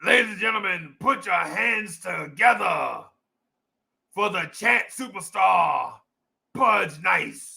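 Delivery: slow, stretched ring-announcer call; announcer intro. Pretend. Introducing the chat superstar to applause, drawn-out announcer voice. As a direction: draw out the words like a stage emcee.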